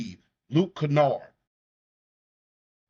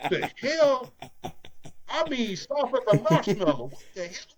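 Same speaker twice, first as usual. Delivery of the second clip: exasperated; 9 semitones higher than usual. Retold or imitated. Mock scolding a soft big man.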